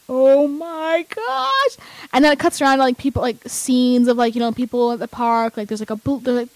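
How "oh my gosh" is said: high, very drawn-out gush; slow squeal of amazement; awestruck fangirl. Retold or imitated. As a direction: stretch each word in rising excitement. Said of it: "And I was like".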